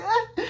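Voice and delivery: very high falsetto yelp; panicked mock shriek. Alice piling things against the door, mock shriek. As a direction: squeal in hammed-up panic.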